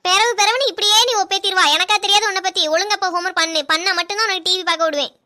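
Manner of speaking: ordinary talk, nothing marked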